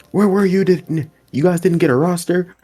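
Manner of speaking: high, sharp questioning coach voice; scolding coach. Retold or imitated. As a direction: play the annoyed coach asking.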